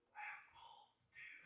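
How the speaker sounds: slow, very soft whisper; imitated confidential hiss. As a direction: whisper it slowly like a secret. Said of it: "We don't have to whisper".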